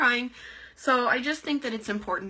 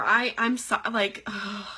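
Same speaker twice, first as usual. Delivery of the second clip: ordinary delivery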